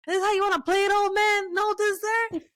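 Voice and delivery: high, loud mock-furious shout; angry kid. Kid yelling at dad over no dessert, high mock shout. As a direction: blow up like an outraged kid. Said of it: "and then he was like".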